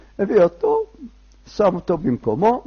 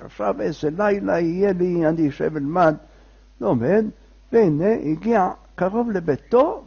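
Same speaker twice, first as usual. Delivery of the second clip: ordinary delivery, nothing marked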